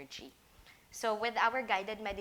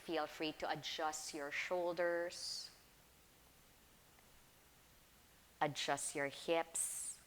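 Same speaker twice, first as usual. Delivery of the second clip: guided meditation. Real, not acted. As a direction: ease them gently into stillness, unhurried.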